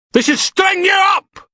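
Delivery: high, slow screaming abuse; angry protester screaming at the prisoner; furious heckler. Acted. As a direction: spit the insults at him. Protester shouting.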